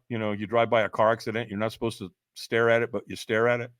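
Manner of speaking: ordinary talk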